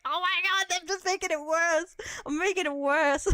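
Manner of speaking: loud, high-pitched comic dismay; mock dismay. Streamer voicing alarm at a joke getting worse, mock dismay. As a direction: cry out as the joke backfires. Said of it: "It's like".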